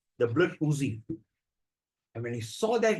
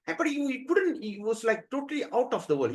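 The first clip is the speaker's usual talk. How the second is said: usual speaking voice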